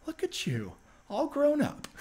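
imitating a proud mentor seeing the kid grown up; Bryan Cranston impression. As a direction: become the veteran actor for a moment, warmly surprised. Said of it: "that was a great impression".

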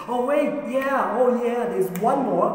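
high, drawn-out dawning realisation; sudden recollection. Acted. Jesse remembers his son in the field, sudden realisation. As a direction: stretch it out high and loud as it comes back.

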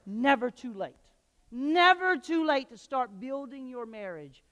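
"Never" loud and drawn out, slow; preached never. Real, not acted. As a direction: call "Never" out to the church. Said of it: "Can you say never?".

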